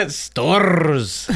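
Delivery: STORRS with a long rolled R, through laughter; hammed-up attempt at rolling Storrs; exaggerated pronunciation. Shown as a demonstration. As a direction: trill the end of Storrs. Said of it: "Please let him keep trying".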